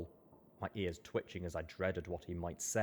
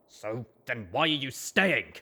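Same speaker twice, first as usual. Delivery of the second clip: louder, higher, cross challenge; cross demand; 5 semitones higher, 10 dB louder than usual. Acted. Colt snapping at Rampage to leave, cross and raised. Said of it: "he demanded crossly".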